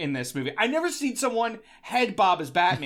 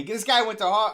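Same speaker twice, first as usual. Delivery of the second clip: accidental slip into a Boston accent; 3 semitones higher, 3 dB louder than usual. Shown as a demonstration. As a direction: slide into a Boston accent without meaning to. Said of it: "I don't know why I'm doing an accent".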